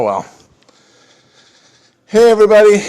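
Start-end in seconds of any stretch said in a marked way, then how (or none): 2.0-2.8 higher and much louder hammed-up hello; bigger greeting. Streamer redoing his hello to viewers, higher and louder. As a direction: restart the intro like a big showman.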